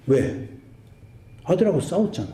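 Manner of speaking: normal talking voice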